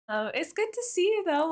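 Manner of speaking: ordinary talk, nothing marked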